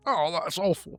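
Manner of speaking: imitated British accent. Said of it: "They're like".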